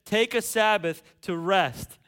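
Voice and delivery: loud, driving sermon delivery; preaching at full force. Acted. Pastor to the church, full-force preaching. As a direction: preach it hard, building the list.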